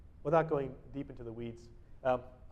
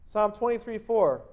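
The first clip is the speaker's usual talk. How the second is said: ordinary talk, nothing marked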